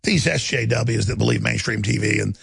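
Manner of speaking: ordinary delivery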